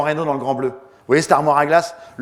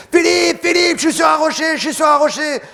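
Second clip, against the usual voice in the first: much higher, loud alarmed shout; Jacques yells; 12 semitones higher, 5 dB louder than usual. Retold or imitated. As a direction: warn him of the rock. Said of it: "Jacques qui gueule". (Jacques crie qu'il est sur un rocher, cri aigu)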